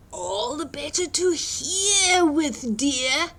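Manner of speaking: slow, sly wolf drawl. Acted. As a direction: tease her with each word. Wolf answers slyly.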